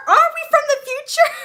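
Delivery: very high, loud mock-excited question; hammed-up excitement. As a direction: squeal the question in imitated excitement. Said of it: "he gets all excited".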